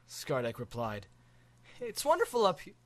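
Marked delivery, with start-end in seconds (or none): 1.8-2.8 put-on higher, delighted boy voice; thrilled kid, high. Boy gushing to the dragon, delighted.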